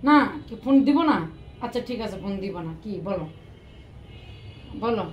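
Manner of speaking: ordinary talk, nothing marked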